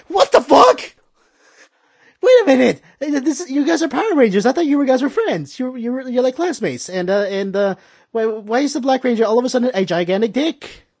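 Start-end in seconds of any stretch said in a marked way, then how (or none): 0.1-6.9 incredulous outburst. Real, not acted. His own disbelief at the episode.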